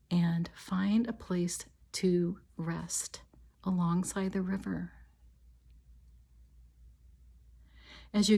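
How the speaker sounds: calm, measured meditation voice with long pauses; guided meditation. Real, not acted. Healer leading listeners on an imagined walk to a river, guided meditation. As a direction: guide them gently, leaving space after each phrase.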